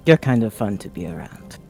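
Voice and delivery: ordinary talk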